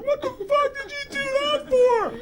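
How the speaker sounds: high, sobbing mock wail; tearful wail. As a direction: wail it like a blubbering victim. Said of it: "the girl is just tears and snot".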